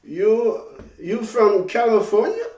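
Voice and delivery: put-on a casual question from a young soldier. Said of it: "The soldier says".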